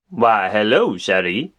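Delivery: lightly voiced Cajun charmer; flirty low greeting; Gambit voice. Figures voiced in a skit.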